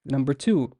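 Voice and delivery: normal talking voice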